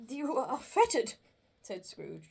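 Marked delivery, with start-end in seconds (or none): 0.1-1.1 trembling. Acted.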